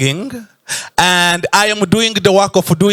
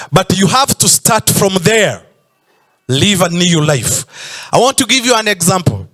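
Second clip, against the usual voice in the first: normal talking voice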